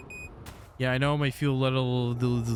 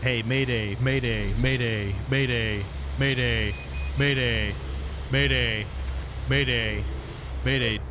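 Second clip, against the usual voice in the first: mayday radio call; much slower than usual. Pretend. Calling for help, out of fuel.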